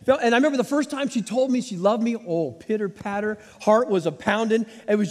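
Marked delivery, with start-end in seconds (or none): none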